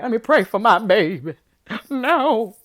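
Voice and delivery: loud, hammed-up mother begging; mock desperate plea. Mother pleading, mouth covered.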